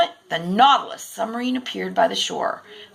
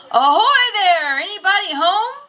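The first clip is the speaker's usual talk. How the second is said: shouted; 10 semitones higher, 8 dB louder than usual. A narrator's reading. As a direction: call out across the water. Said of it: "shouted Miss Smith".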